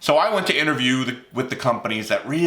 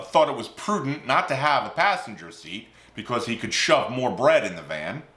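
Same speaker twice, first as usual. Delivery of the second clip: ordinary delivery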